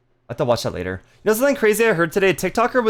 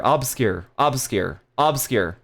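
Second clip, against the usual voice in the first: ordinary talk, nothing marked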